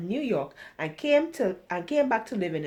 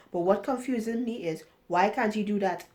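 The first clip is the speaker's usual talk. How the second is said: ordinary delivery, nothing marked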